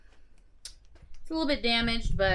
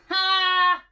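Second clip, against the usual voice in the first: sharp loud "ha"; triumphant laugh; 9 semitones higher, 8 dB louder than usual. Real, not acted. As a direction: burst out with a loud ha. Reacting to scanner error.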